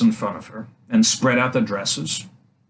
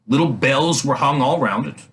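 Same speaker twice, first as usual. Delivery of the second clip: ordinary delivery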